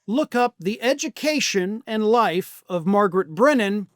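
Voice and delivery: ordinary talk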